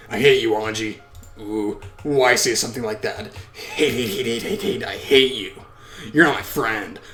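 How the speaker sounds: bitter, forceful put-on voice; furious child witch. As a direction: chant the hate, then pronounce banishment.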